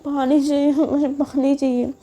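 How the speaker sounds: lightly voiced pleading drunk; a hint of a childish whine; whiny begging. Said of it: "तो बोलते".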